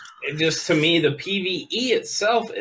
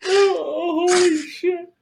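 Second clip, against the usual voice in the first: very high, wheezing laugh; crying with laughter; 12 semitones higher, slower than usual. Real, not acted. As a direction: squeak out the words laughing. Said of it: "I'm fucking crying".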